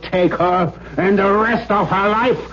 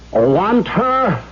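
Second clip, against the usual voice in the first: usual speaking voice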